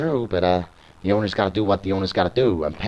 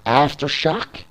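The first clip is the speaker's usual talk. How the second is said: pitched up in disbelief; incredulous question; 8 semitones higher, slower than usual. Retold or imitated. Malice answering the owner's offer, mildly incredulous. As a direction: query it as if insulted by the offer.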